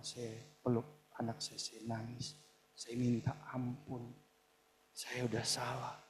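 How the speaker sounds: low, very soft and slow; hushed, remorseful recollection; subdued confession. Real, not acted. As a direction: admit fault quietly, with pauses. Recalls repenting to own child.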